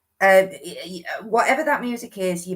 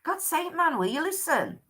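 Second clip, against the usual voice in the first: exasperated; 9 semitones higher, 3 dB quieter than usual. Retold or imitated. Spirit's exasperation voiced.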